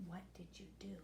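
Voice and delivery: put-on old man drawn in, asking quietly; hushed question. A narrator's reading. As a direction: ask it in a hush.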